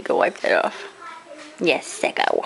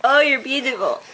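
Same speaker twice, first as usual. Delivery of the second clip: ordinary talk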